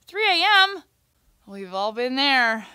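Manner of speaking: usual speaking voice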